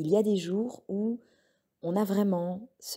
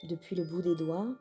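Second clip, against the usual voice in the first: soothing meditation voice, one short phrase at a time; guided relaxation; 3 semitones higher, 6 dB quieter than usual. Acted. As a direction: lead a calm body scan, unhurried and even. Leads a guided yoga nidra.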